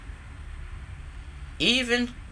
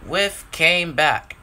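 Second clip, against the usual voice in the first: ordinary delivery, nothing marked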